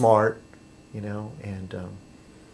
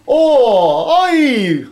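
high, loud stage-Irish exclamation; hammed-up Irish "oi!"; mock Irish accent; 10 semitones higher, 5 dB louder than usual. Vlogger hamming up an Irish accent for viewers, loud mock Irish. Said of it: "pardon my Irish accent".

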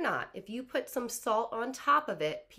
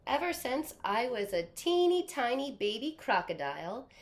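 ordinary delivery

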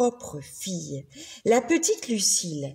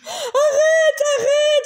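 much higher, louder panicked cry; terrified shout; 6 dB louder, slower than usual. A narrator's reading. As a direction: scream to stop the monster's mouth. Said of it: "s'écria le roi".

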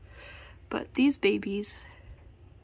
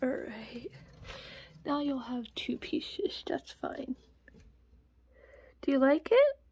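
whisper. Real, not acted. Owner whispering while her rat eats apple. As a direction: hush while she eats.

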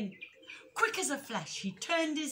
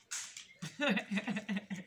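light laugh. Real, not acted. Story ends.

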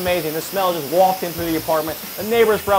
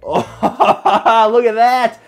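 higher, louder laughing exclamation; delighted laugh; 6 semitones higher, 7 dB louder, much slower than usual. Real, not acted. Cook lifts lid, laughs delighted at brisket.

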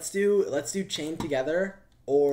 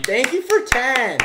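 excited thanks; 10 semitones higher, 11 dB louder, slower than usual. Real, not acted. Excited donation shoutout.

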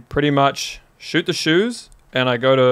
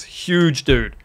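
normal talking voice

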